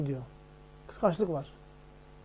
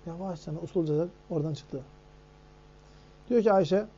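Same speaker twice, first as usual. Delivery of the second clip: usual speaking voice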